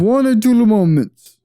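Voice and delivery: put-on a joking stab at a Russian accent; bad Russian accent. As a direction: toss off a clumsy Russian accent. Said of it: "I just cannot do a good Russian accent".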